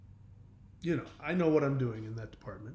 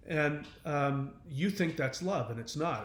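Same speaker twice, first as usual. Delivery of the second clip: ordinary talk, nothing marked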